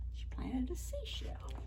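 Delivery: soft whisper, as if sharing a secret; hushed aside. Real, not acted. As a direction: confidential storytime whisper. Hushed aside to child listeners.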